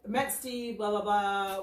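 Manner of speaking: usual speaking voice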